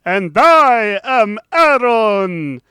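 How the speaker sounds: loud, slow spooky announcer. Acted. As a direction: boom it out like a haunted host. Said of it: "because I'm spooky".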